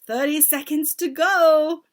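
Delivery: loud, slow countdown call; ringing theatrical announcement; witch crying out. A narrator's reading. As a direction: proclaim it high and drawn out. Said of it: "cried the Grand High Witch".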